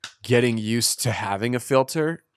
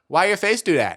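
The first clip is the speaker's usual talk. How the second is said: put-on blunt kid; 8 semitones higher, 3 dB louder than usual. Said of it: "they see emotion and they're like".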